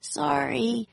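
small, high squeak; very high-pitched timid apology. A narrator's reading. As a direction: squeak it out sheepishly. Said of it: "Jack squeaked".

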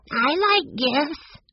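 put-on slow, high, trembling little boy; shaky voice. A narrator's reading. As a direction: quaver it out nervously. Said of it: "he said in a shaky voice".

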